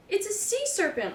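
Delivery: high, startled cry; mildly voiced screaming beachgoer; mock scream. A narrator's reading. Beachgoer sees seaweed dog, screams in mild alarm. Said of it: "one of them screamed".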